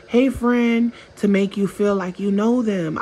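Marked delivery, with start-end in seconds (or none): 0.1-0.9 fake friendly greeting. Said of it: "they even call you names".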